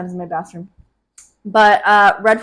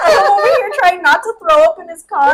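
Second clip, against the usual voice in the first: high, loud laughing through words; 8 semitones higher, 7 dB louder than usual. Real, not acted. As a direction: laugh hard while telling it. Laughing retelling drunk ride.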